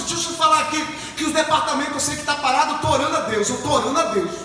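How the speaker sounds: ordinary talk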